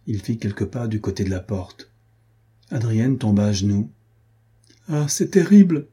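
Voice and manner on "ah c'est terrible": high, loud anguished cry. A narrator's reading. Adrienne on her knees before Jean de Werth, crying out loud. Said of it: "s'écria-t-elle".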